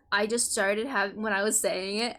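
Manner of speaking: ordinary talk, nothing marked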